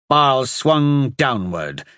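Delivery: ordinary delivery, nothing marked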